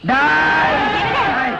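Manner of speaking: high, drawn-out shout; angry yell. Acted. Enraged man to fleeing men, angry yell. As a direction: holler after them as they run. Yells at men running away.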